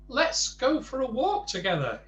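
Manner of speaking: high, bright little helper voice. Acted. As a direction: lift it up for the small man. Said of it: "he suggested".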